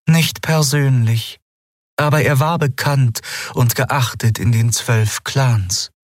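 lightly voiced ancient being; Aylan's voice. A narrator's reading. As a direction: speak with cool composure.

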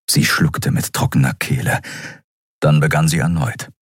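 plain reading voice

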